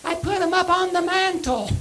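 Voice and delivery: squeaky voice answering the caller's question; squeaky little voice. Retold or imitated. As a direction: squeak it out like a tiny kid. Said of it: "this squeaky little voice".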